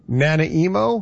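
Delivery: "Nanaimo" said as NA-na-EE-mo; joke mispronunciation. Shown as a demonstration. Host defending an easy joke, "Nanaimo" mispronounced as NA-na-EE-mo. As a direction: turn "Nanaimo" into nana-emo, rising at the end. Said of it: "Nanaimo is an easy joke".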